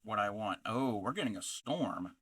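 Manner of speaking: normal talking voice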